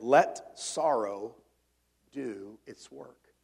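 put-on a preacher savouring an old hymn verse; reverent hymn recitation. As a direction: linger on every word of the stanza.

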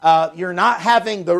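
normal talking voice

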